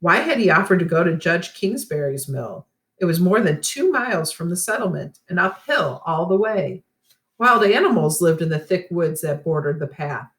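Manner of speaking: usual speaking voice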